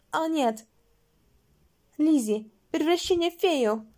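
high, loud transformation cry; dramatic exclamation. A narrator's reading. Lizi transforms into a fairy, loud high exclamation. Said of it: "воскликнула Лизи".

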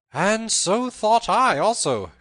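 high, loud, drawn-out call; gleeful cry. A narrator's reading. Robin bursts from the thicket, crying out merrily. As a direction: cry it out slowly, bursting with mirth.

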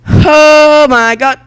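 ecstatic shout. Real, not acted. Gamer celebrating a triple kill, ecstatic slow shout. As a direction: scream it in triumph.

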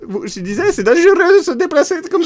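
high, loud squeaky voice breaking into laughter; high character voice, laughing. Acted. Streamer in character cracking up, high and loud. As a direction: push the silly voice high and loud.

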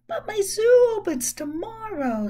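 put-on a little girl pleading; young girl voice. Amelia Bedelia's line.

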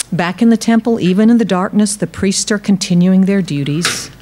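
usual speaking voice

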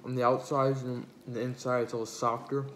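talking between bites; chewing while talking. Real, not acted. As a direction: mouth full of food. Seen 0: Takes repeated bites and chews pizza throughout the talking.

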